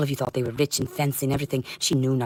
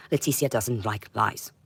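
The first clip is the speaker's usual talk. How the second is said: normal talking voice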